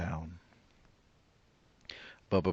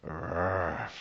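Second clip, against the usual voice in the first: bear growl. Acted.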